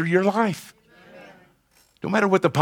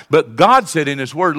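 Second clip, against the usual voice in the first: ordinary delivery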